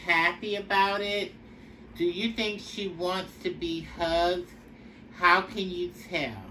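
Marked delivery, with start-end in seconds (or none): none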